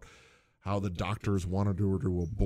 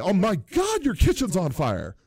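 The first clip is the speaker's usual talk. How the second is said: higher, louder sudden alarm at the fire; 10 semitones higher, 5 dB louder than usual. Retold or imitated. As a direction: blurt it out as a startled boss. Chalmers discovers the fire.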